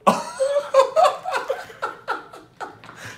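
real burst of high laughter. Real, not acted. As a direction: crack up helplessly. Laughing after scaring scammer.

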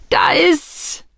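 high, frantic outbursts; frightened lad giving up the goods; panicked surrender. A narrator's reading. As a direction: blurt it in terror, in separate bursts. Terrified lad hands over papers.

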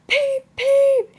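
very high, loud "peep" whistle imitation; train whistle. A narrator's reading. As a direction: pipe it out like a steam whistle. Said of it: "he whistled".

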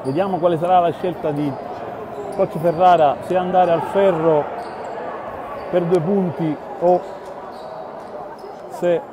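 measured basketball commentary, building tension; live play-by-play. Real, not acted. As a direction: call the final seconds steadily.

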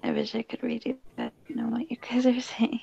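hushed whisper so nobody wakes; whispering late at night; quiet at night. Real, not acted. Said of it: "I'm talking quiet because my roommates are asleep".

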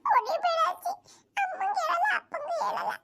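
high, whimpering plea; pleading child. Acted. Grandson begging granny not to hit him, high crying plea.

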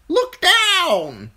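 very high, loud bird squawk; commanding crow. A narrator's reading. Crow commanding Bran during the fall, high squawk. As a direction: order it in a piercing bird voice.

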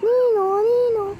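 calling the name out high and loud. Real, not acted. Player calls out to find friend, loud and high. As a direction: shout the name across the map.

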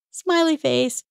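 lifted high and light. Retold or imitated. Host adds the emoji to soften an email, playful and high. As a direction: pop it up brightly like a grin. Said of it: "Because I wasn't being mean".

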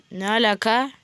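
normal talking voice

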